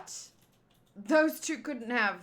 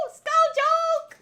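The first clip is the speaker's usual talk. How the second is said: punchline callout; 8 dB louder than usual. Real, not acted. Punchline callout after skeleton pun.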